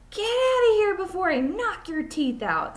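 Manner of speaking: high, loud snarl of a threat; angry threat. Acted. Allison threatens Jason on the playground, loud and high. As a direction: warn him off, loud and high. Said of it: "said Allison".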